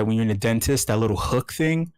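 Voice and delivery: normal talking voice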